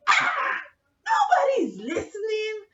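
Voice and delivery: high, loud scoffing yell; drunken dismissal. Acted. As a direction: wave it off in a squealed shout. Drunk doctor brushes off her objection.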